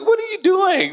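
high, startled cry of disbelief; shocked teen. Retold or imitated. Teen caught by angry mother, high shocked yelp. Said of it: "I'm like".

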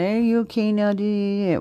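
drawn-out, put-on friendly voice; mock casual check-in. Imitating exes after unblocking, mock casual check-in. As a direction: imitate a sheepish make-up call.

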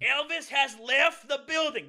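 high, loud, slow announcer-like coach; coach catchphrase impression. Imitating the coach announcing a student leaving. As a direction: boom it out like a corny coach. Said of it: "This is literally something he would say".

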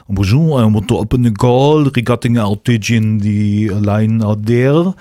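put-on drawn-out English in a French accent. French customer phoning to open a support call, French accent. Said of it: "der Franzose ist immer so".

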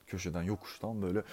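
ordinary delivery, nothing marked

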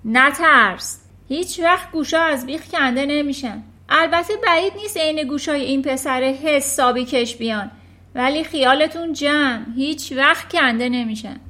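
loud dismissive shout; shouting back. Acted. Trunchbull yelling back at Miss Honey dismissively. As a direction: bark back over the noise. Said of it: "ترانچبول داد زد".

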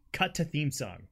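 ordinary talk

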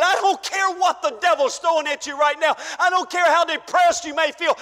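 normal talking voice

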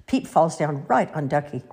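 ordinary delivery, nothing marked

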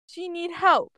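put-on high, stubborn little-kid voice; scowling child. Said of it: "Ash scowls and says".

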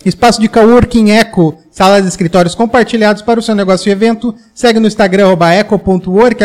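sponsor ad read. Acted. Reads the sponsor list.

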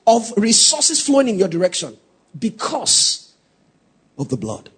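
preaching at full force. Acted. Preacher presses the congregation on God's love, shouting. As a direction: build the point to the congregation.

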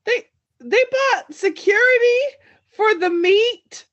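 can't believe they guarded meat; shocked disbelief. Real, not acted. Friend hearing the meat fact, shocked disbelief. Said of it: "stupidest thing I've ever heard".

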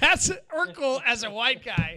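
loud, laughing call-out; loud teasing. Real, not acted. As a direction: crow it at a friend, loudly mocking. Loud mocking reaction to impression.

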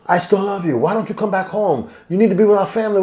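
lightly voiced wife begging him home; pleading wife. Wife begging her husband to come home, soft high plea.

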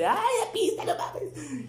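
muy agudo, entre risas, como niño emocionado; emoción de niño imitada. Retold or imitated. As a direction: imita al niño que grita feliz con su pista. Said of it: "así". (niño emocionado imitado entre risas)

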